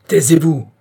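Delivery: king shouting down the drummers; terrible shout. A narrator's reading. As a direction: silence the drums with fury. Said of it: "cria-t-il avec un accent terrible".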